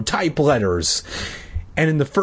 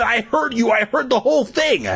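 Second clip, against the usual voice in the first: angry shout; 11 semitones higher than usual. Acted. Todd yelling at Patrick that he heard it all. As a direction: shout it, sure he heard everything.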